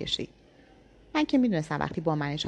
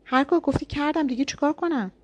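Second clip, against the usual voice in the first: much higher, louder desperate shout; shouting back; 9 semitones higher, 4 dB louder than usual. A narrator's reading. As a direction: cry out at the end of your rope. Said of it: "منم داد زدم".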